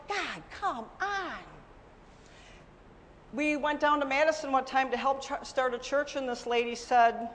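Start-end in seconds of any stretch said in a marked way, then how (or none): 0.1-1.4 soft, drawn-out exasperated plea; exasperated sigh. Retold or imitated. Frustrated believer sighing at God, soft exasperated plea. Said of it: "I'm like".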